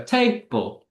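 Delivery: "table" slowly, strong first syllable, weak second; "table" as a strong-weak beat; stressed then unstressed. Shown as a demonstration. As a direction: punch the start of "table", let the end drop. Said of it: "stressed followed by unstressed".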